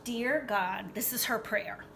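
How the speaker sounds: ordinary delivery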